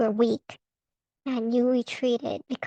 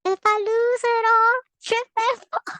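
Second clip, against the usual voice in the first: much higher silly sing-song voice; mock sing-song; 10 semitones higher, 4 dB louder than usual. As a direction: chant it like a goofy rhyme. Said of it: "That's dumb".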